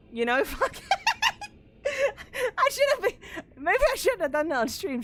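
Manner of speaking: cracking up, high-pitched laughter through the words; helplessly laughing while trying to talk; real laughing fit. Real, not acted. Streamer regretting own joke on stream, cracking up.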